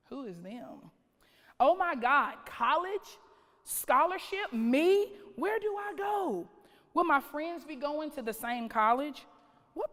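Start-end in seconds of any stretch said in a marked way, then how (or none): 0.1-0.9 whisper. Acted. Questioning teacher's remark, hushed. 1.6-8.7 rising higher and higher as the disbelief turns to joy; building excitement. Acted. College scholarship news, rising excitement.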